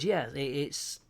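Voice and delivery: ordinary delivery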